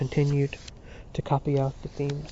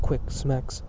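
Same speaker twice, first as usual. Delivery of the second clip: plain reading voice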